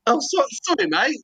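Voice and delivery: sheepish apology. Retold or imitated. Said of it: "self reply to workman".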